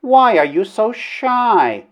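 high, slow lady's voice; wooing princess, coaxing. A narrator's reading. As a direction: tease him tenderly, high and drawn out. Princess teases shy suitor.

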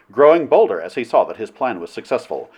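ordinary delivery

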